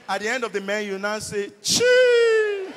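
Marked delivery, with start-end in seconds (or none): 1.6-2.7 long, very high, loud squealed syllable; demonstrated high-pitched exclamation. Shown as a demonstration. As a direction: let out a sustained piercing cry.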